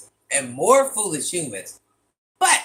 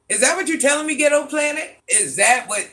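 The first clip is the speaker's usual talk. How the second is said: higher and louder, challenging disbelief; incredulous; 8 semitones higher, 5 dB louder than usual. Real, not acted. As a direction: demand an answer, can't believe it. Incredulous challenge to chat viewer.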